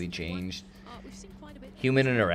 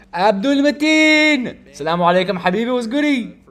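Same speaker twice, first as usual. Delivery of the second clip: big whooping welcome, pitched way up; hollered greeting; 12 semitones higher, 10 dB louder than usual. Real, not acted. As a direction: holler an excited hello to a friend. Hailing a viewer on stream.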